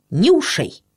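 ordinary delivery, nothing marked

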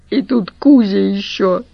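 ordinary delivery, nothing marked

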